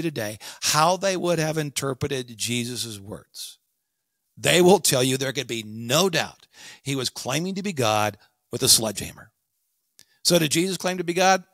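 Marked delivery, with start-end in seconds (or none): none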